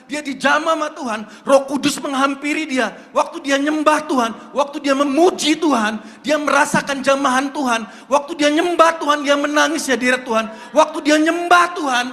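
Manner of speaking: loud, rising preacher's cry, building to shouts; preaching at full force. Acted. Preacher to youth about meeting God, shouting. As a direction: preach it hard to the congregation.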